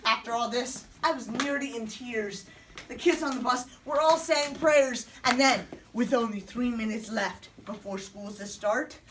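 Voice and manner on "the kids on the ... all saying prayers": ordinary delivery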